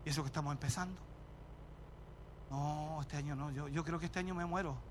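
a parishioner whining to the pastor about a terrible year; complaining parishioner. Retold or imitated. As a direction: moan it like a tired sick believer. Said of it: "hermanos que me dicen".

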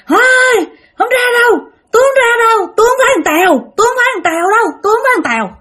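very high, insistent protesting voice; possessed boy refusing. Acted. Possessed boy hiding from villagers, high stubborn refusal. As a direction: dig in and protest in a high-pitched voice. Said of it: "nó nói".

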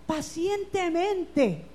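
drawn-out, calm "pacientemente"; slow and patient. Shown as a demonstration. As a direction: stretch "pacientemente" out slowly. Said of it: "No dice desesperadamente". (lento y paciente)

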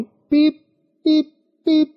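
a high tone, sung out as pip; high beep. Shown as a demonstration. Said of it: "وہ یا high note ہوگا".

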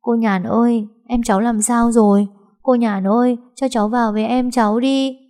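giọng trẻ con cao, mếu máo; bé gái vừa mếu vừa xin gặp em. A narrator's reading. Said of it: "Thấy cô Nhàn nó mếu máo". (bé gái mếu máo xin gặp em, giọng cao)

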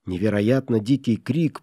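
usual speaking voice

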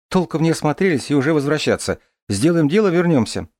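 put-on light, lively girl's voice; young woman voice. Дарья спорит. (голос девушки)

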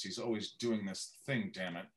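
low, softened impression; Kevin Spacey. Actor showing off his impressions, low Spacey voice. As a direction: drop the voice down and quieten, trailing to a mutter. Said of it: "Kevin Spacey where I do this voice".

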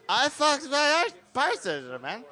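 put-on slow, high-pitched drunk slur; hammed-up drunk rambling. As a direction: drag the words out drunkenly. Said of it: "That was his accent".

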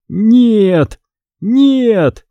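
high, drawn-out cries of horror; horrified cry. A narrator's reading. Greg stares at the camera, crying out in horror. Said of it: "вновь закричал Грег, с ужасом глядя на камеру".

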